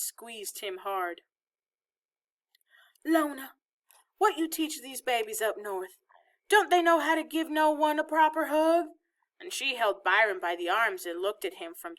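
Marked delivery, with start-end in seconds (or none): 3.0-8.9 higher-voiced old Southern woman; scolding Southern grandmother. A narrator's reading. Grandmother to her daughter about the grandchildren, scolding. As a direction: chide them as the family's grandmother.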